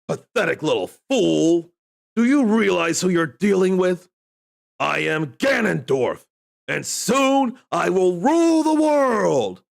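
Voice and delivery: booming villain voice, rising to a shout; megalomaniac rant. Acted. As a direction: rant like an evil overlord. Voicing Ganondorf from the Lego set.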